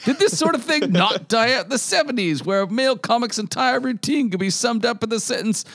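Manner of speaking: high, hammed-up indignant scolding; over-the-top outrage. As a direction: read it like a pompous outraged critic. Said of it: "It's so over the top".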